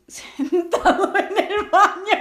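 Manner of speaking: bursting into laughter mid-word; high laughing squeal at the punchline. Real, not acted. Telling friends the bathroom scare, laughing.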